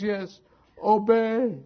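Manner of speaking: put-on high, drawn-out childish answer; slow, high mock-child punchline; wailing little girl. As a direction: stretch it out like an aggrieved child. Daughter reveals the dreaded word.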